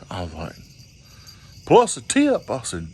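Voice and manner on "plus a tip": dancer's voice. Retold or imitated.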